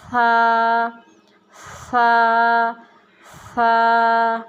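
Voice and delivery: سا with the tongue between the teeth, soft, no whistle; gentle سا, tongue slightly out; soft tha. Shown as a demonstration. Teacher showing children a soft سا with no hiss. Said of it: "نرمی سے اس کو ادا کرنا ہے".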